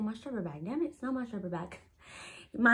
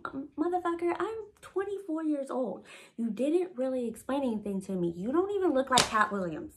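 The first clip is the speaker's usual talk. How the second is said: high-pitched, exasperated retort; indignant comeback; 5 semitones higher than usual. Retold or imitated. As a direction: argue back in rising indignation. Arguing back in retold altercation.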